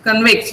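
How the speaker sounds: Convict as cun-VICT; second-syllable stress. Shown as a demonstration. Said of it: "is a verb".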